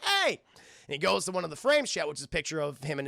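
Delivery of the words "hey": high, loud "hey"; indignant shout. Acted. Brother as door slams on him, indignant shout. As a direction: yell out in protest.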